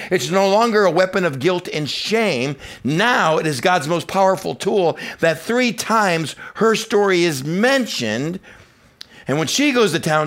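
high, impassioned sermon delivery; full-force preaching. Real, not acted. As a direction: preach it out to the whole congregation.

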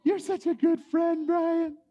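high, lightly voiced girl; a hint of a sweet girl friend-zoning him; girl letting him down. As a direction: let him down gently, high. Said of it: "the friend talk".

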